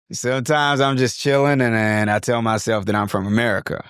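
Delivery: casual, imperfect American accent; attempted American accent. Shown as a demonstration. Teacher telling himself he's from America, American accent. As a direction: have a go at relaxed American. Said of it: "I can't even do the American that well".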